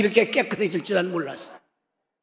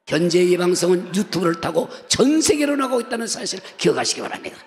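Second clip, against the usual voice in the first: ordinary talk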